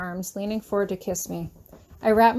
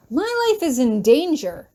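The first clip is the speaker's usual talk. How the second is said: higher and louder, shouting at him; 4 semitones higher, 4 dB louder than usual. A narrator's reading. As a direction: throw it at him in alarm. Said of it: "I shout at him".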